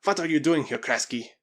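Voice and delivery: loud, surly challenge. A narrator's reading. Spaniard confronts Krasky on the trail, surly. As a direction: challenge him gruffly. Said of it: "in a surly tone".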